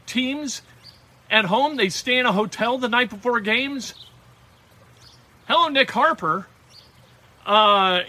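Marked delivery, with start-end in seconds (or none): none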